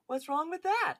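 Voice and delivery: Jeff. Retold or imitated. Podcaster redoes the quote in character, high-pitched impression. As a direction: pitch it way up into a cartoonish mock voice. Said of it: "any, uh, quote you do by Jeff".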